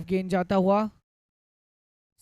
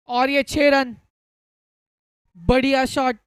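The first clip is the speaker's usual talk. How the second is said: raised, loud burst for a six; excited; 4 semitones higher, 7 dB louder than usual. Real, not acted. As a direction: call the six with a lift. Six hit, commentator erupts.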